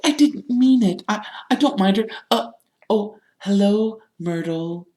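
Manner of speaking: high, flustered, stumbling excuse; frantic Hermione. A narrator's reading. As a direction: backtrack nervously, softening into an awkward greeting. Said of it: "Hermione whispered frantically".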